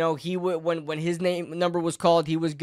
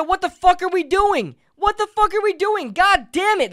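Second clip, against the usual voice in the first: higher, louder exasperated yell; a quarterback's outburst at the play calls, furious; 11 semitones higher, 4 dB louder than usual. Retold or imitated. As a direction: belt it out like a fed-up quarterback. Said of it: "He said".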